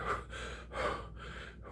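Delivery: heavy panting between words; gasping after running uphill; breathless gasp. Retold or imitated. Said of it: "I'm trying to talk".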